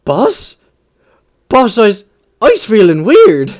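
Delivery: a big brute suddenly unsure of himself; confused orc. Retold or imitated. Transformed orc telling the boss something feels off, puzzled. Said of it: "the orc is just like".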